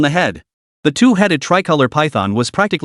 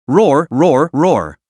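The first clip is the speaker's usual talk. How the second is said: lowered growl; much slower than usual. Acted. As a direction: growl it down, cowed but defiant. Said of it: "lowered its voice and growled".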